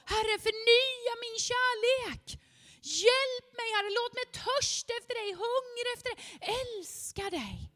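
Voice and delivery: high, earnest plea to God; pleading prayer. Acted. (bedjande bön i högt röstläge)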